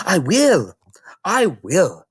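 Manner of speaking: put-on a small girl answering the librarian; Matilda, young girl. Little Matilda explaining to Mrs Phelps, young girl voice.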